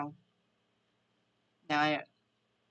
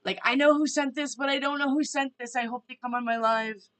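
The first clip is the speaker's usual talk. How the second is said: surprised; 5 semitones higher, 6 dB louder than usual. Retold or imitated. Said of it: "and then I was like".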